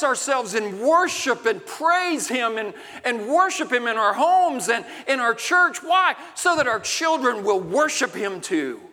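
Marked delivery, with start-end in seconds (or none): none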